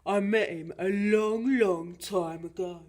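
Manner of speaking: big storytelling monster dad; Gruffalo father. Acted. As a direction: recall it loudly, stretching the long vowels. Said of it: "said the Gruffalo".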